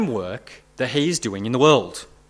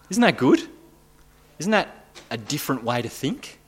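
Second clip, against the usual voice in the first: normal talking voice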